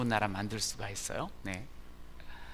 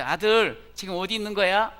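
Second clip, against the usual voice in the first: mother asking; 7 semitones higher, 9 dB louder than usual. Retold or imitated.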